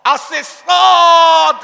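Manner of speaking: সদ drawn out very slowly, loud and high; long booming সদ; Arabic letter demo. Shown as a demonstration. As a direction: stretch সদ like a heavy Arabic sound. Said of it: "আপনি পড়বেন সা".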